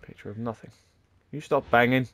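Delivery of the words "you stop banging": sharp annoyed shout to stop; annoyed yell. Real, not acted. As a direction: snap loudly at the banging noise. Yelling at a noise in game.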